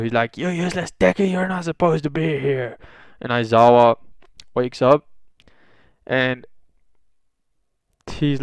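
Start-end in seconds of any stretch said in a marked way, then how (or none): 0.4-2.8 high-pitched imitation yell, held down in volume; mock screaming. Classmate berating Deku on arrival, mock screaming. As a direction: strain it high like a hushed scream. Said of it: "he's fucking screaming at Deku".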